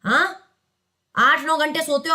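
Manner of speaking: ordinary delivery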